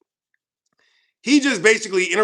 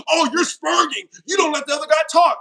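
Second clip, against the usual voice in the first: higher, shouted mock complaint; mocking whiny critic; 6 semitones higher than usual. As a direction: whine it like a sore loser. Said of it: "be like".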